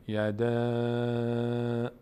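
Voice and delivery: "یده" with the last vowel sustained on a level pitch; elongated madd. Shown as a demonstration. Teacher timing three finger closings, "یده" greatly stretched. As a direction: hold the end of "یده" long and even. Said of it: "شروع کنیم مثلاً یده".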